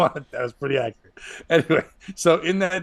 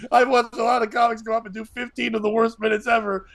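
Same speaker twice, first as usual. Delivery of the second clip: ordinary talk, nothing marked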